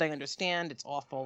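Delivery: low, soft retold agreement; subdued sympathy. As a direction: drop it right down, resigned. Agent's sympathy set against her reply.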